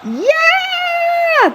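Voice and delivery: very high held cheer; fans scream. Acted. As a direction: scream like a crowd. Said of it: "the fans all screamed".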